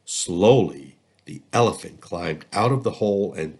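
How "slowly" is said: plain reading voice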